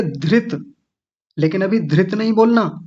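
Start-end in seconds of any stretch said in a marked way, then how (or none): 0.0-0.7 धृत ending clipped with a half त; half-t version. Shown as a demonstration. Teacher showing how a half letter would sound. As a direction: demo धृत with the final त cut short. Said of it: "अगर ये आधा होता तो कैसे बोलते?".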